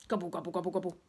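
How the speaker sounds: mock gobble, gobble; a greedy eater's gobble; gobbling noise. Gobbling sound of eating.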